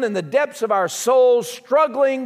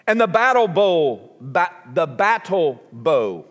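southern accent; 6 semitones lower than usual. Real, not acted. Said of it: "in case you can't understand my southern accent".

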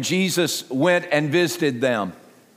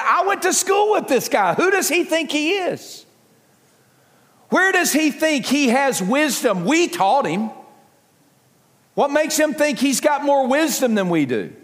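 higher, indignant mocking of a local boy; scornful townsfolk; 7 semitones higher than usual. Hometown crowd scoffing at Jesus, indignant and mocking. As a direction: scoff at him as a nobody. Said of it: "Some people among the crowd said".

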